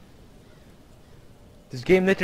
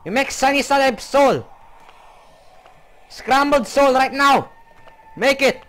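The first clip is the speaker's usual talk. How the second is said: high, loud, hammed-up battle yells; mock battle cry; 8 semitones higher, 5 dB louder than usual. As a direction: charge in shouting like a cartoon hero. Hurling eggs at the soul.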